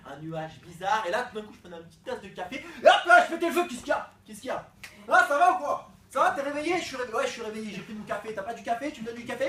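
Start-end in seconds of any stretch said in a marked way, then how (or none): 2.6-3.7 sudden loud jolt of energy; hyped-up coffee high; wired on coffee. Acted. Caffeinated burst after coffee sip. 3.7-9.5 loud, jumpy caffeine addict; over-caffeinated pest; wired and jittery. Acted. Caffeine-wired character hassling people.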